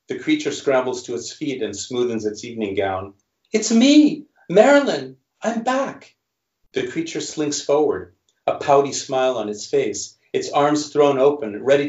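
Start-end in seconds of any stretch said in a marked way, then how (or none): 3.5-6.1 high, louder, coaxing Marilyn voice; starlet plea. Acted. Creature tells Joe it is Marilyn, high pleading voice. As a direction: announce yourself as the returned star.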